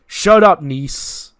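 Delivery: loud, high burst, dropping on the name; angry shout. Acted. Ray silences the interfering Nese, shouting angrily. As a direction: cut him dead with a sudden yell.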